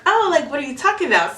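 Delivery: high, quick imitation of a puzzled coworker; quoting someone caught off guard; puzzled surprise. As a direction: jump up in pitch, mock-confused. Acting out coworker's puzzled reply.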